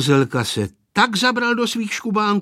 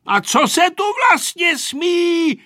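put-on high, loud, exasperated boy; impatient shout; 14 semitones higher, 7 dB louder than usual. A narrator's reading. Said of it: "vykřikl netrpělivě Vojta". (netrpělivě vykřiknout jako kluk)

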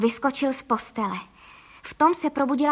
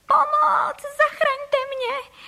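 very high, drawn-out loud cry; screaming for help; 14 semitones higher, 5 dB louder, much slower than usual. A narrator's reading. Wife lifted into the air, screaming for help. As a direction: wail slowly at the top of the voice. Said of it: "začala křičet".

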